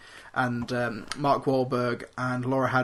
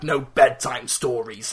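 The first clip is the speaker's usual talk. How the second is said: Crosshairs quote; 2 semitones lower, 4 dB louder, slower than usual. Retold or imitated. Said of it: "who's like".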